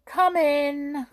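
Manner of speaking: high, loud call to the door. A narrator's reading. Hop calls to the visitor at the door, high and loud. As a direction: call out to the knock. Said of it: "cried Hop".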